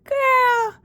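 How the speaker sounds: high mother-voice reaction; imitated mother. Mother learning of the April Fool prank, imitated high voice. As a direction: play a mother reacting, voice pitched well up. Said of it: "She was like".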